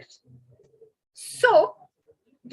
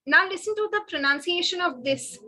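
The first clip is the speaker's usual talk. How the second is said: ordinary talk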